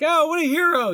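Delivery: high, loud imitation of an admiring crowd; mock admiration. Host voicing sailors praising the dog, mock-admiring exclamation. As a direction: exclaim it like impressed onlookers, hammed-up. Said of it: "And they're like".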